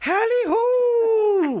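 long, very high falsetto hoot; mock hailing call. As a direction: imitate a drawn-out falsetto holler. Mimicking the narrator's high holler.

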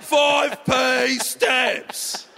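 very slow, high, shouted mock butcher; butcher's voice. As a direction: drag each word out like a menacing shopkeeper. Mocking the butcher's voice.

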